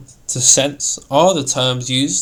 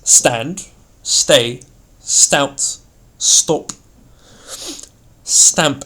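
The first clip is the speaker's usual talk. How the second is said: STAND, STAY, STOUT, STOP, STAMP each hit firmly and louder; forceful, separate single-word stresses; firmness and strength; 5 dB louder, much slower than usual. Shown as a demonstration. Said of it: "denotes firmness or strength".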